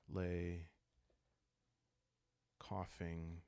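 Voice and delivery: ordinary talk, nothing marked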